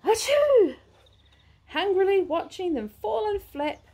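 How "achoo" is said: loud sneeze. A narrator's reading. Big sneeze in story.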